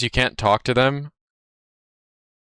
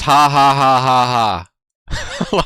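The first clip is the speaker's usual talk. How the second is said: loud, slow, evenly spaced fake "ha ha"; mock typed laugh; 4 dB louder, slower than usual. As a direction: read out a taunting laugh word by word. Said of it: "You have to say something mean over the message".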